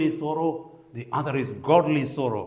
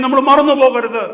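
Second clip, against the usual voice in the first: loud, high admonition; raised preaching appeal; 8 semitones higher, 10 dB louder than usual. Real, not acted. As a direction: urge the congregation with a raised voice. Reminder repeated in raised preaching voice.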